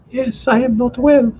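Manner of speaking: high, faint admission of pain; young wife in pain. A narrator's reading. Liza admits pain.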